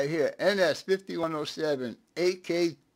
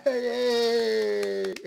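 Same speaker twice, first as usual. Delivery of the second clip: cheering; 6 semitones higher, 4 dB louder than usual. Real, not acted. Cheering the working counter.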